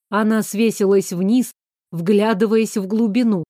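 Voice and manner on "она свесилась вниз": plain reading voice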